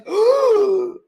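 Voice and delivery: sharp, high startled gasp; a big startled intake of breath; classic gasp. Retold or imitated. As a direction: gasp loudly as if spotting danger. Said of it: "my classic noise".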